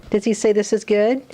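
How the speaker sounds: plain reading voice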